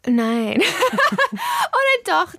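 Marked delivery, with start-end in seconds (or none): 0.6-2.3 real laugh, high and slow. Real, not acted. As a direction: laugh through the words. Laughs while correcting herself.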